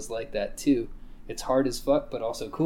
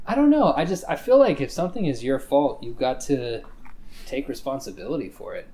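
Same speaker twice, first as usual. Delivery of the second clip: ordinary talk, nothing marked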